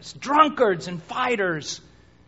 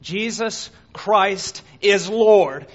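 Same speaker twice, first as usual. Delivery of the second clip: slow, louder declaration; bold confession; 7 dB louder, much slower than usual. Acted. Believer before the soldiers, bold slow confession. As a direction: stand firm and declare it.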